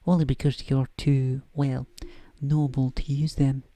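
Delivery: put-on a slightly overdone Scottish accent, briskly; Scottish McGonagall. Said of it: "almost bad Scottish accent".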